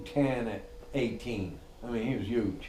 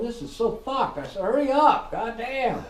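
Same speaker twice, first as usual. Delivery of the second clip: high, loud, frantic urging to hurry; panicked yelling; 13 semitones higher, 7 dB louder than usual. Retold or imitated. Boss yelling at loaders in thick smoke, frantic and loud.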